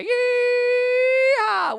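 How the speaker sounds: high whooping cowboy shout; yeehaw. Acted. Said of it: "give me a big yeehaw".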